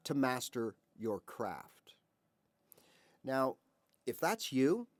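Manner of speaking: normal talking voice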